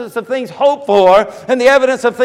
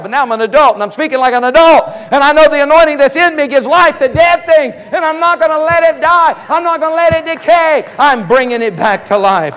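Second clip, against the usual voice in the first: higher, louder defiant declaration; fiery preaching; 5 semitones higher, 5 dB louder than usual. Real, not acted. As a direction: build to a forceful, high preaching cry. Preaching at full force.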